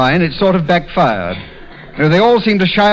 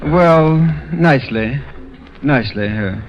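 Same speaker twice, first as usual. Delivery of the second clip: unenthusiastic; slower than usual. Acted. Asked by Don how it goes, unenthusiastic. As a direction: answer without conviction, letting it sink. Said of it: "You don't sound very enthusiastic".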